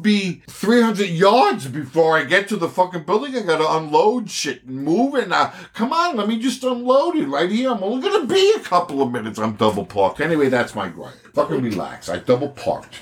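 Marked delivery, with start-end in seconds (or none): 5.3-9.4 high, pleading ask to be let off; mock pleading. Pleading to park, mock plea.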